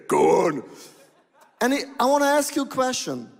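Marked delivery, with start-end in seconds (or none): 0.1-0.6 laughing. Retold or imitated. Retelling joking reply, laughing.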